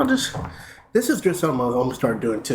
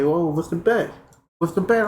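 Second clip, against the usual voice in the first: surprised; 6 semitones higher than usual. Retold or imitated. Friends react to the bet.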